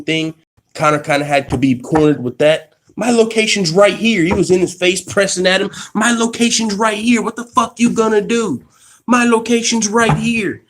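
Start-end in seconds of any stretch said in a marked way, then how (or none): 3.0-10.6 imitating McGregor. Mocking McGregor taunting Khabib at the presser. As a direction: get in his face, taunting.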